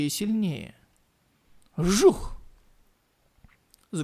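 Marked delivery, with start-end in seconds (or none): 1.8-2.4 whoosh sound. A narrator's reading. Sound effect in fight narration.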